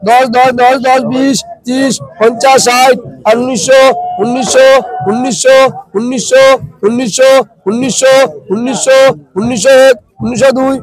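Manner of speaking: steady rhythmic bid calling; auctioneer. Real, not acted. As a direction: chant the rising prices like a market auctioneer. Calling bids at fish auction.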